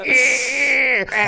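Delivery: high creaky "eh" of stuck gears; imitated straining gears. Shown as a demonstration. Explaining a stuck brain to co-host, imitated gear squeal. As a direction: squeal like gears trying to turn.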